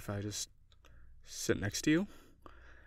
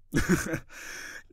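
laugh; 6 semitones higher, 4 dB louder than usual. Acted. After pineapple pizza joke.

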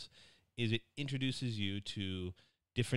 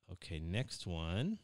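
ordinary talk, nothing marked

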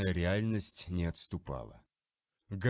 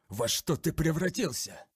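horrified; 6 semitones higher than usual. Acted. Captain horrified at the monster.

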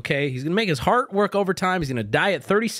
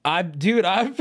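usual speaking voice

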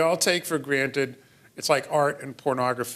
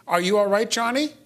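high, louder, rising question; child's scripted echo; 8 semitones higher, 6 dB louder than usual. Acted. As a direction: parrot a concerned adult, high and rising. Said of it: "the child may say".

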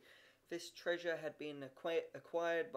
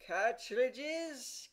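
high, loud, scandalised echo; horrified scornful question; scorn and horror; 7 semitones higher, 6 dB louder than usual. A narrator's reading. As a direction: recoil at the word in horror. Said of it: "continued Peter in scorn and horror".

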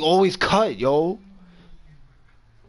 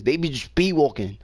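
ordinary delivery

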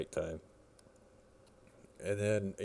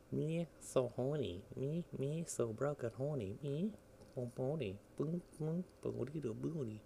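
goofy silly voice; 7 semitones higher, 6 dB quieter, slower than usual. Pretend. Goofy singsong voice over game.

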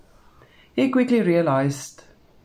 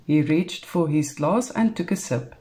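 usual speaking voice

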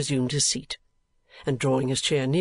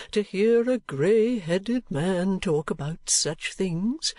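put-on higher-pitched, halting old gentleman; old gentleman confessing lost love; 5 semitones higher, slower than usual. Grey-headed man telling Nicholas whom he loved, confessing. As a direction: confess it haltingly, lingering on phrase ends.

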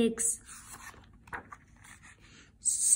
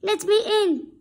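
higher calling wolf voice; 6 semitones higher, 4 dB louder than usual. Acted. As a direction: call out to lure the pig. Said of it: "he called".

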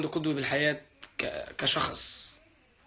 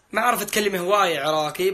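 put-on a light try at an Iraqi accent, a bit higher and louder; Iraqi dialect; 4 semitones higher, 4 dB louder than usual. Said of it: "إذا ما بتحكي عراقي". (محاولة خفيفة بلهجة عراقية)

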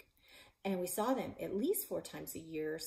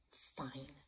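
grudging muttered fine; sulky whisper; 4 semitones lower, 12 dB quieter than usual. A narrator's reading. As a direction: sulk under your breath. Sulky reluctant reply.

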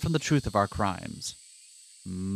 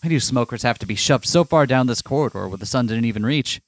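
plain; 3 semitones lower than usual. Acted. Reads game narration aloud.

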